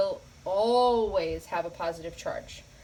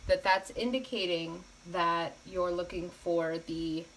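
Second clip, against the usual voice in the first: usual speaking voice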